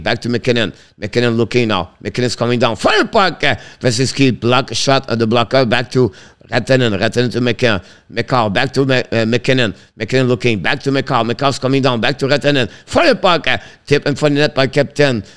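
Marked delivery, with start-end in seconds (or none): 2.8-3.4 sudden high jump on fire puck; excited shot call. Real, not acted. Commentator calling a Colorado shot, excited. 12.8-13.5 sudden high jump on fire puck; excited shot call. Real, not acted. Commentator calling a Colorado shot, excited.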